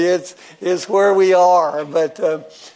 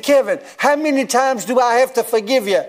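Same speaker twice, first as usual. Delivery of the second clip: high-pitched, exasperated question; acted exasperation, pitch pushed up; 6 semitones higher than usual. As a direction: retell it as fed-up pleading. Acted exasperation at repeat offender.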